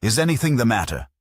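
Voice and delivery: sharply. A narrator's reading. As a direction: snap the question out. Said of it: "he added sharply".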